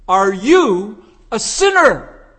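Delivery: loud, slow "Are you a sinner?" with "sinner" falling hard; emphatic question. Shown as a demonstration. Preacher showing how evangelists should ask, loud and slow. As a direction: call it out to the whole room. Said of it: "That's what you say".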